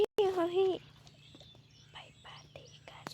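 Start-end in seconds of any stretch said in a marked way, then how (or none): 1.9-3.0 very soft hushed whisper; soft whispered invite. Acted. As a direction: barely breathe the invite so nobody wakes. Secret invitation at night.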